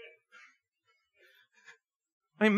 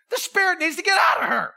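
higher, shouted deliverance command; mock rebuke; 9 semitones higher, 3 dB louder than usual. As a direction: cast out the spirit with a mock shout. Imagined rebuke of spirit.

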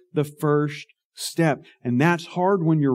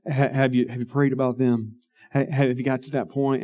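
soft, lightly voiced coaxing wife; mild imitation of a tender spouse; sweet wife, mimicked; 4 semitones lower, 4 dB quieter than usual. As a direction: gently nudge her honey, quieter. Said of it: "my sweet wife is going".

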